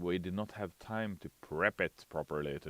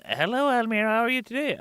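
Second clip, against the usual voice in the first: much higher chirpy greeting; high viewer voice; 7 dB louder, faster than usual. Pretend. Viewer greeting the streamer, high and chirpy. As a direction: chirp it like a fan.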